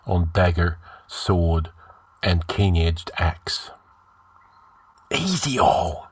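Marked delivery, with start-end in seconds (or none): 5.1-6.1 hissed command. A narrator's reading. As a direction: hiss it to the men as a hushed order. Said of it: "hissed Ascalante".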